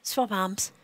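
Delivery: ordinary talk, nothing marked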